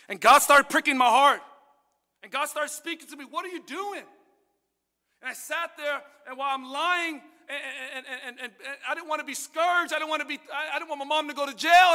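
loud, high preaching build; fervent testimony preaching; preaching at full force. Acted. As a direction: preach it rising with passion.

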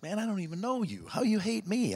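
high, startled, baffled question; bewildered disbelief. Retold or imitated. Preacher reacting to the stranger's hatred, high and bewildered.

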